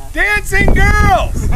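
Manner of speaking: high, full-volume holler, words stretched long; barker's shout. Pretend. Performer luring passers-by with dancing girls, drawn-out shout. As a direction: sell it loudly to the whole street.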